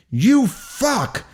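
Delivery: furious muffled yell; muffled scream. Acted. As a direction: scream it against sealed lips. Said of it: "I screamed through my sealed lips".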